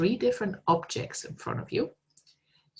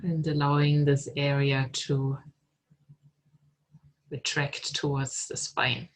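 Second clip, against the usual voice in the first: calm, unhurried meditation guide voice; guided meditation. Acted. Teacher guiding students into meditation, calm and unhurried. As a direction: lead them gently inward, with long pauses.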